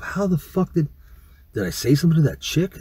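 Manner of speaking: high-pitched retold inner panic; alarmed bewilderment. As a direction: replay a stunned thought, pitch jumping up. Alarmed thought after dude reveals secret.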